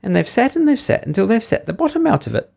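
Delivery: high, squeaky little bear; shrill baby bear. A narrator's reading. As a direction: squeak it like an upset bear cub. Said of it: "squeaked the Baby Bear in his shrill little voice".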